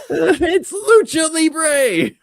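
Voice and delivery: high, slow, laughing shout. Retold or imitated. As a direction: yell it out through laughter. Laughing mock writers' excuse.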